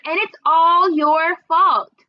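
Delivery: loud, high, slow blaming shout; boy yelling blame at his sister; hollered accusation. A narrator's reading. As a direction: throw the blame at her. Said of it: "he hollered".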